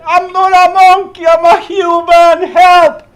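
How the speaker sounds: high, loud terrified yelling; panicked scream. Retold or imitated. Man in monkey suit screaming at lion, panicked yell. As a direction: scream for your life at the lion. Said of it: "And I scream again".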